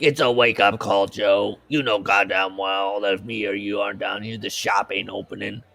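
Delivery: a put-on tough Jersey guy voice; put-on working-class voice, scolding the boss; gruff Lenny. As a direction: lay it out like a worn-down worker. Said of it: "Lenny's like".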